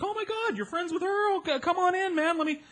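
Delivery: a high, breathy-free gushing welcome, lightly voiced; excited partygoer. Stranger at party welcomes him in, high excited gush.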